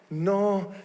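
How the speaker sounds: ordinary talk, nothing marked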